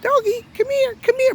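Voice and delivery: high-pitched coaxing call to a pet; dog-calling voice. Retold or imitated. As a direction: imitate her sweet high voice for the dog. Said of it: "But she changed her voice".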